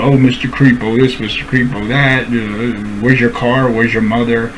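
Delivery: low, quiet sneering mimicry of a bully's taunts; mocking his taunts. Mocking the streamer's name-calling at him, low and quiet. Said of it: "all he can do is call me names".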